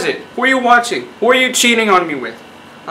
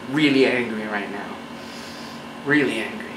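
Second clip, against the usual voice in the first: understated anger; 9 semitones lower, 10 dB quieter than usual. Acted. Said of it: "I'm really angry".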